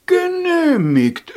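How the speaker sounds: very high, loud, ringing call; chorus of gods. Acted. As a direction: cry it out like many voices. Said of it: "riefen die Götter im Kreis".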